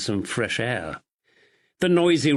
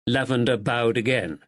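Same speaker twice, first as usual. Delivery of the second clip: plain reading voice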